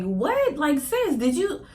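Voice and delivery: high-pitched incredulous questioning; frustrated. Retold or imitated. Scolding herself over a bad test score, frustrated disbelief. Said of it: "I was so frustrated".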